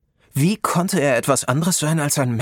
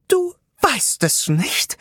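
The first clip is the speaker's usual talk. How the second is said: much higher, slow gasp of a tiny sorceress; gasping little sorceress; 11 semitones higher, much slower than usual. A narrator's reading. Little sorceress gasps at Rob, high and slow. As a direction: gasp it out like a tiny astonished witch. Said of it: "japste eine besonders kleine Zauberin".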